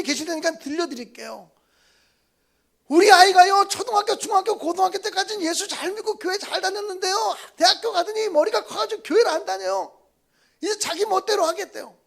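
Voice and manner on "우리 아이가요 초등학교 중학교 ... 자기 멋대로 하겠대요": complaining parent voice. Retold or imitated. Voicing a complaining church elder.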